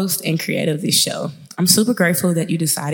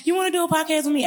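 much higher, quicker hopeful question; eager ask; 10 semitones higher, faster than usual. Retold or imitated. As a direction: ask Amo fast and hopeful. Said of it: "I was like".

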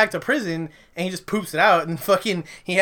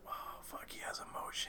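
whispered, stunned reaction; barely audible whisper of disbelief; hushed awe; 22 dB quieter than usual. Retold or imitated. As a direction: whisper it as if quietly amazed. Awed reaction to someone crying.

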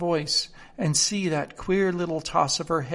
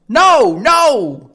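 much higher, loud, imperious queen; a shrill-sounding, loud Queen of Hearts; 10 semitones higher, 15 dB louder than usual. Acted. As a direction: cut him off like a tyrant. Queen overrules the king.

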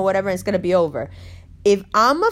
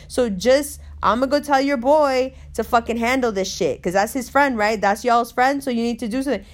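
usual speaking voice

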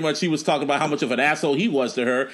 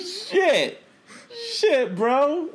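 laughing disbelief; 13 semitones higher, much slower than usual. Real, not acted.

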